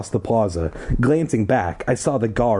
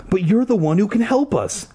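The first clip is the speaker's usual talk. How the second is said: much higher panic; high panicked girl; 11 semitones higher than usual. A narrator's reading. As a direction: scream it high, mild. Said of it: "Ashley screamed".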